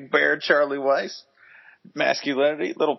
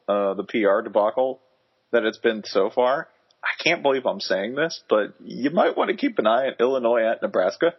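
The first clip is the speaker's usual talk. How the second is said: usual speaking voice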